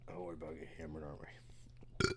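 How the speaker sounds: hushed whisper. Real, not acted. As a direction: whisper it like a secret. Aside before drinking.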